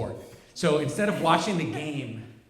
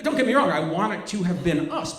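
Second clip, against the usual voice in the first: normal talking voice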